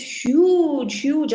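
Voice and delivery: HUGE stretched out long; stress on huge. A narrator's reading. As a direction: drag out each "huge" for size. Stressing size of the Rakshasis.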